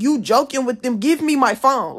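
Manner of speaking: loud, high angry demand; pissed off, retold. Pissed student confronting staff member for her phone, loud retold anger. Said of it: "So now I'm pissed".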